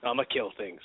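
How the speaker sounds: low, soft, offhand character voice; mock-casual killer, understated and low; imitating nonchalant brawler. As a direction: drop low and toss it off casually. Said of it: "he's just running in just like".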